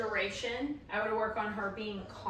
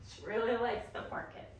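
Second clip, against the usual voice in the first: ordinary talk, nothing marked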